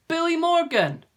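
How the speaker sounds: high, loud call down the road; shout. A narrator's reading. Jonathan shouts after the departing bully. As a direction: shout after him to stop him. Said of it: "Jonathan shouted".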